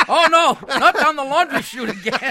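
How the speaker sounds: high laughing cat voice; voicing the cat. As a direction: whine it like a cat dreading the chute. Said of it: "ma means".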